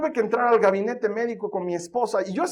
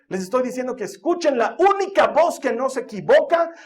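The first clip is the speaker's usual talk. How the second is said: louder, rising preacher's build; preaching; 4 semitones higher, 4 dB louder than usual. Acted. Pastor urging the church to hear God first, full preaching voice. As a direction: hammer the point home to the congregation.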